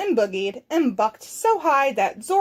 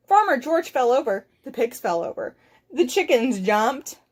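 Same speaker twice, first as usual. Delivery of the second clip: usual speaking voice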